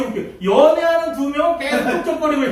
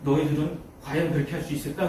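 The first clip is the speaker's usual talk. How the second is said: low, hushed, searching question; sober challenge; 9 semitones lower, 8 dB quieter than usual. Retold or imitated. Evangelist asking children after Peter's death, gravely quiet. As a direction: drop low and soft to challenge them.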